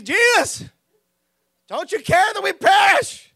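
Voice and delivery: high, loud, frantic plea over the storm; panicked cry to Jesus. Retold or imitated. Disciple in the storm begging Jesus, panicked shout. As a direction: yell it like the boat is sinking.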